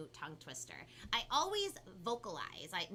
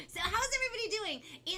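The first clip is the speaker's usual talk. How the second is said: cheery greeting; 12 semitones higher, 5 dB louder than usual. Real, not acted. Greeting viewers on stream.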